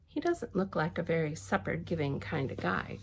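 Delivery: ordinary delivery, nothing marked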